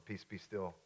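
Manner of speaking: very soft, low command; calm command. Acted. Jesus speaking to the storm, in a hushed calm. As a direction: barely raise the voice, quietly in control.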